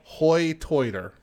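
Hoy Toiter with oy for the long i; High Tider accent. Shown as a demonstration. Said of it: "That's how they pronounce it".

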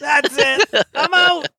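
mock outrage. Mock-storming off after music cut.

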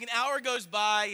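normal talking voice

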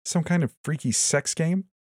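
high, incredulous scoff; snort, then ask in high-pitched disbelief; derisive, high-pitched questioning. A narrator's reading. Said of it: "I snorted".

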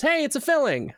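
high, upbeat announcement; imitating cheery dentist. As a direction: break good news in a sing-out voice. Retold dentist announcing good news.

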